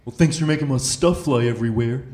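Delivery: the cop's dry complaint, slightly put-on; grumpy cop. Cop grumbling at the driver after the stop, lightly voiced. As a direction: quote the officer's sarcastic gripe. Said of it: "he just looks at me and he goes".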